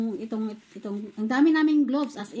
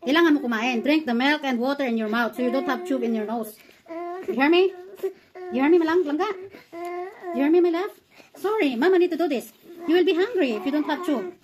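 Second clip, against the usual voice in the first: raised, insistent voice, climbing higher; loud urgent pleading; 5 semitones higher, 5 dB louder than usual. Real, not acted. Mother telling her child she must eat, loud and pleading.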